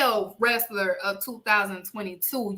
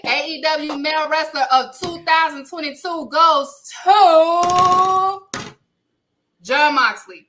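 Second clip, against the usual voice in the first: big awards-show reveal; award announcer; 4 semitones higher, 6 dB louder than usual. Acted. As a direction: build to the winner's name.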